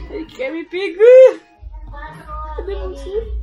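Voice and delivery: loud, slow, frightened cry; being chased. Real, not acted. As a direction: shout it in real panic.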